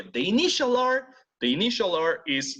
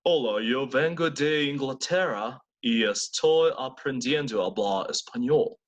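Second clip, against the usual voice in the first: British accent; 3 semitones lower than usual. Shown as a demonstration. Teacher acting as English learner, British accent. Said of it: "como si fuera británico".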